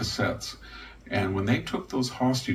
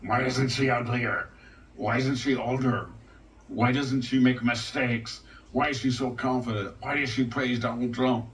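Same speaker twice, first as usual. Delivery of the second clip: mock outrage from critics watching her, pitched higher; whiny, put-upon, a bit higher; mocking indignant critics; 3 semitones higher than usual. Commentator mimics left-wing critics, lightly mock indignant. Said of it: "the faces of the left-wing people like".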